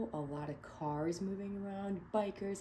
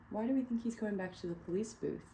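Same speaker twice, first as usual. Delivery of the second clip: ordinary delivery, nothing marked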